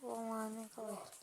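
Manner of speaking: ordinary delivery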